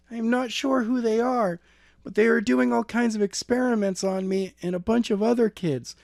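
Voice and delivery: put-on high girl's voice, urgent and scared; frightened girl. A narrator's reading. Kidnapped girl telling Skylar on the phone, high and urgent.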